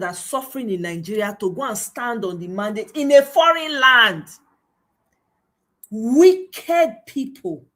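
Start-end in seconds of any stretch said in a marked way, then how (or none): 5.9-7.6 slow, loud, higher-pitched scolding; drawn-out condemnation. Real, not acted. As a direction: stretch it out loudly in disgust. Condemning mandate supporters abroad.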